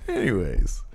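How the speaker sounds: ordinary delivery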